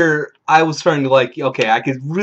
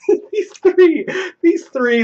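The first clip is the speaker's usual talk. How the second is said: high-pitched, words squeezed through laughter; laughing while talking; slower than usual. Real, not acted. Storyteller amused by his tale, laughing through words. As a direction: crack up mid-sentence.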